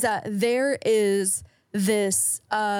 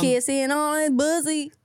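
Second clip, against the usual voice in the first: put-on country accent; 4 semitones higher than usual. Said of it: "your country accent".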